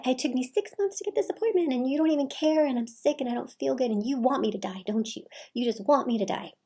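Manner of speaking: hammed-up martyr mother; guilt-tripping mother. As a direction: pile on the guilt like a hurt mom.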